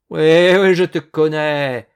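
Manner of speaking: very loud, drawn-out scorn; angry shout. Acted. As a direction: bellow it accusingly. Hunter shouts accusation at farmhand.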